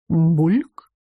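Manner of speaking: low start swooping up like a question; bubbling potion speaks, questioning upswing; бульк зелья. A narrator's reading. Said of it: "И снова сказала". (булькающее зелье, с вопросом)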